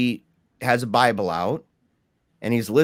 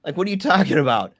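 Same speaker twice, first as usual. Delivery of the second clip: higher-pitched mock disbelief; 7 semitones higher, faster than usual. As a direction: toss it back as a scoffing question. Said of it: "wanted so badly to mock him".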